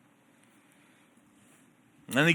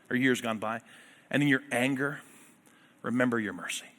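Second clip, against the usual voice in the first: prayerful reading; 4 semitones lower than usual. Acted. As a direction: pray it, then let it sink.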